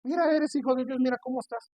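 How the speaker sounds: the devil taunting the believer, high mock voice. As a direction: sneer it like the tempter. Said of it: "no le creas al diablo".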